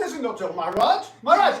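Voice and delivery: crying out to the king; desperate loud appeal to the king. Acted. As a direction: plead loudly to Maharaj. Pleading to the king.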